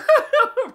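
sudden loud high burst of laughter. Real, not acted. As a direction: explode into a disbelieving laugh. Shocked at player's game.

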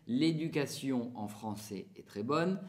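the whole line in French pronunciation: L'éducation en français est très bonne; French sentence. Shown as a demonstration. Teacher reads the French sentence to Spanish learners, in French. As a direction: read the sentence as a French speaker would. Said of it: "en español sería".

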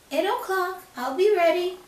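high, loud little pig. Acted. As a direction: chirp it eagerly. Pig replies.

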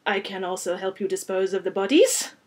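put-on tentative French-accented offer; French-accented vampire, slightly embarrassed; rich French accent. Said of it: "with a rich French accent".

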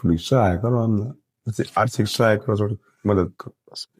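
pleading through tears, voice fading; crying plea. Real, not acted. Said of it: "કેમ રડો છો કાકા?". (રડતાં રડતાં મદદની વિનંતી)

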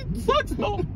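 high, squeezed out through laughter; laughing complaint. Real, not acted. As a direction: admit it while cracking up. Laughing at own misfortune.

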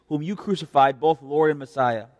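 normal talking voice

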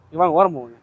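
ordinary delivery

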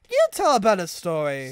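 loud, irritated snap back; snapped retort. A narrator's reading. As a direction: snap it out loudly. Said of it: "snapped Thomas".

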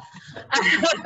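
bright burst of laughter; laughing. Real, not acted. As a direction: laugh out loud. Laughs at own accent joke.

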